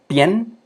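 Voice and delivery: teacher isolating the syllable pien; one-beat syllable demo. Shown as a demonstration. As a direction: say pien as one quick stroke. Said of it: "es un solo golpe".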